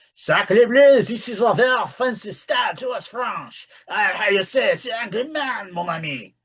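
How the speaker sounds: hammy French accent, high and loud; overdone French accent, shouted start; french chef. Shown as a demonstration. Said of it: "use regional dialect sparingly".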